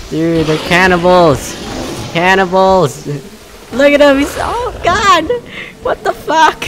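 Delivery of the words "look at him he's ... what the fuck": much higher voice in stunned disbelief; shocked squeal. Real, not acted. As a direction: squeal it out, floored by the carnage. Shocked at cannibal dinosaurs.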